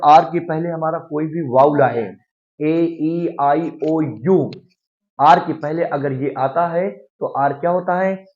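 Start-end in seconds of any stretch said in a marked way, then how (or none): none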